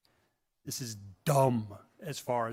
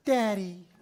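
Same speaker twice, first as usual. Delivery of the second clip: put-on child's voice; 7 semitones higher, 5 dB louder than usual. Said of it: "the child looks over at your dog and says".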